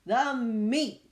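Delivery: greedy pirates roaring for meat. Acted. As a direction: bellow it for food at a rowdy table. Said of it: "we all roared".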